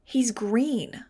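high, incredulous exclamation; almost shrieking. A narrator's reading. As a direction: blurt it high in disbelief. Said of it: "Iggy almost shrieked".

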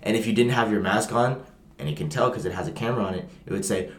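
ordinary talk

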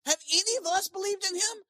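high, loud haughty voice; haughty Pharisees. Retold or imitated. Pharisees scolding the officers, high and haughty. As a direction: look down your nose. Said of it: "I love that answer".

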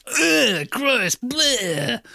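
high, exaggerated retching distaste; hammed-up revulsion, pitched high; mock disgust. Host imitates film character's crude reaction, disgusted.

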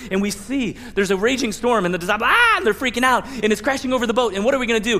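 usual speaking voice

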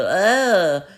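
imitated yelp of someone falling; high exclamation of alarm; startled grunt. Robber slipping in spilled soup.

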